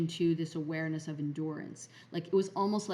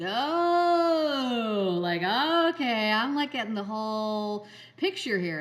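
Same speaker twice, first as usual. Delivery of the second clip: high and loud, bursting with sudden understanding; the lightbulb moment, almost shouting with glee; excited realization; 5 semitones higher, 7 dB louder, slower than usual. Retold or imitated. Narrator reliving a revelation, high, loud and thrilled.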